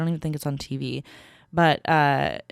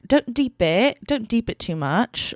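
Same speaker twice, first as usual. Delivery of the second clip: higher-pitched British Love Island lingo; Love Island UK accent; 5 semitones higher, slower than usual. Retold or imitated. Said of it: "saying some of their lingo".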